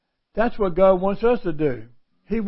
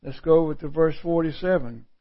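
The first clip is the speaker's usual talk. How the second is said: usual speaking voice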